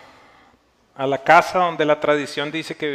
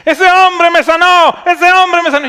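high, drawn-out retold shout; 14 semitones higher, 11 dB louder than usual. Healed woman tells a crowd who healed her, shouting. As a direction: yell it out as the astonished woman, far louder and higher. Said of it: "Y empieza a gritar".

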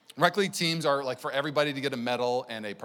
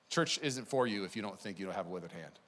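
usual speaking voice

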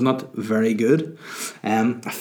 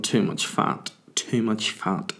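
hushed, disapproving whisper, getting softer; muttered whisper; 6 dB quieter, slower than usual. Retold or imitated. Reviewing his own show video, whispering critically. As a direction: whisper it to yourself while editing. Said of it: "I'm looking at it going".

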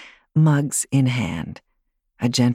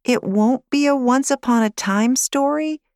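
disappointed; 11 semitones higher than usual. Acted. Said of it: "Lily asked with a sad face".

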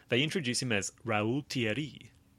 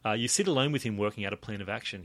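usual speaking voice

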